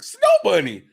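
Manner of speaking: high, loud cry; loud excited shout. Retold or imitated. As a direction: yell it out gleefully. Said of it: "producer's like".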